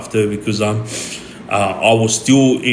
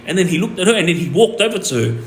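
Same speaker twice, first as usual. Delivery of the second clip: ordinary talk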